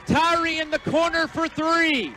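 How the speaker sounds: high, slowed shout of the call; excited play-by-play call. Real, not acted. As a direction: belt out the winning shot. Repeating the game-winning call.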